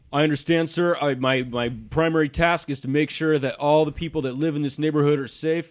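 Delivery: a hint of an officious guard. Guard warning the wizard about private residences, stiff. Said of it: "He says".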